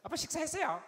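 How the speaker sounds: high, loud daughter voice; pushy younger daughter. Retold or imitated. Second daughter asking dad about his meal, boisterous. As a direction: imitate a daughter pestering dad at full pitch. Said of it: "우리 둘째 딸".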